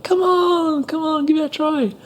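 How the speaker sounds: very high, coaxing chorus; coaxing classmates. Retold or imitated. Classmates urging the nervous newcomer, very high and coaxing. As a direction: egg him on in a high sing-song. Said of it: "everyone turns around ... and is like".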